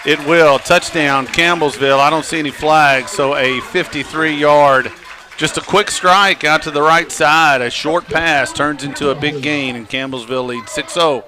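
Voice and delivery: loud, lively touchdown call; live play-by-play. Real, not acted. Announcer calls a first-play touchdown to listeners, excited play-by-play. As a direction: call the score with punchy, projected energy.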